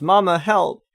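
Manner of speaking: put-on a child calling out for help. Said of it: "my son goes".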